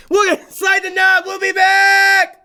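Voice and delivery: high, loud and drawn-out send-off; called-out sign-off. Real, not acted. Host throwing to a commercial break, sung-out holler. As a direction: belt out the last words, stretched long.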